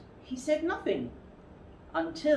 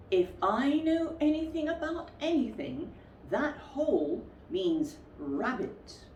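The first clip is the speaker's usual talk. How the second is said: a hint of a higher bear voice; lightly voiced bear; 6 semitones higher than usual. Pooh thinking aloud at the rabbit hole, lightly voiced. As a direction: muse like a simple, hopeful bear.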